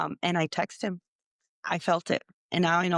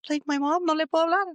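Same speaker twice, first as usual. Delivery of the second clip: retold panic; 10 semitones higher, 3 dB louder, faster than usual. Retold panic over the mother.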